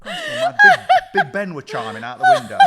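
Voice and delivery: loud, high, laughing shout; real laughter bursting through the punchline. Real, not acted. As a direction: crack up as you get the words out. Cracking up at the story.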